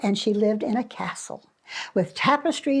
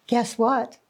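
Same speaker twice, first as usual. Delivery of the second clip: usual speaking voice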